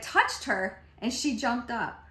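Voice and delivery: normal talking voice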